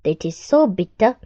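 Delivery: expressive read-aloud storytelling; story narration. Acted. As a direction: tell the crocodile tale to children. Reads The Enormous Crocodile aloud.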